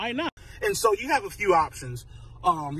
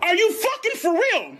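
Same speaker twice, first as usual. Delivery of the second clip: incredulous; 12 semitones higher than usual. Acted. Speaker asking the viewer in disbelief, high. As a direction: throw the question up high in disbelief.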